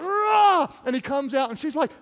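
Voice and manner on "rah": loud, high "rah" roar; roar of effort. Acted. Preacher as Samson tearing loose, roaring. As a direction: burst free yelling. Imitating Samson breaking free.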